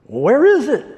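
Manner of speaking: high, loud mock disbelief; the brash rancher hunting for the suite; incredulous Texan. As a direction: rich Texan scoffing at the suite. Texan mocking small suite.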